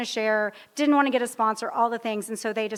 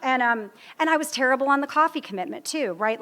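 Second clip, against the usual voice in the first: ordinary delivery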